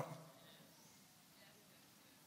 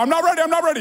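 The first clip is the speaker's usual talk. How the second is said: high, loud mock whine; whiny protest; 10 semitones higher, 4 dB louder, much faster than usual. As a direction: beg off like a kid. He argues with God.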